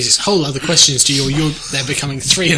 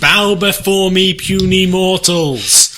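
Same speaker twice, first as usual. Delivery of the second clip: slow, high, loud godlike proclamation; booming demon lord; 4 semitones higher, 5 dB louder, slower than usual. Acted. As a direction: boom it down at the puny mortals. Diglett as god addressing mortals.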